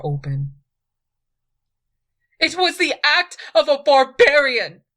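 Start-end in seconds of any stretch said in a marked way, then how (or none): 2.4-4.8 loud, much higher angry shout; furious outburst. A narrator's reading. Queen rages about her rival's cruelty, shouting. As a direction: hurl the accusation.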